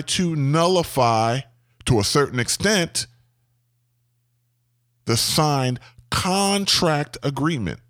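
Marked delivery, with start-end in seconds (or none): 6.1-7.8 "contract agreement" slowly, CON drawn out; over-the-top emphasis. Shown as a demonstration. Owner warning about nullifying it, slow stress on "contract agreement". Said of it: "put emphasis on the word contract agreement".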